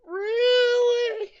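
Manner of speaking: piercing, stretched-out squeal; squealed disbelief. Real, not acted. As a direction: escalate to an outraged squeak. Repeats disbelief after crash.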